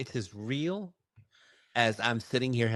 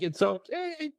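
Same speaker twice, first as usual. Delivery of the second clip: gentle reassurance. Retold or imitated. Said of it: "And she's like".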